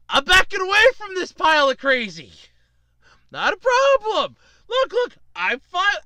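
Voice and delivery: hammed-up appeasing of a crazy person; placating. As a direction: ease away from a madman, exaggeratedly. Acts out placating the raging winner.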